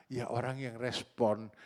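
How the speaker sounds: ordinary delivery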